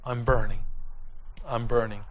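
low, hushed retold dying words; grave warning. Preacher asking youth to imagine saying these words, hushed. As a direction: say it low and soft.

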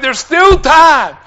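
loud, high, slow plea; retold desperate urging; urgent pleading. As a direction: shout it insistently, drawn out. Pleads back over his yelling.

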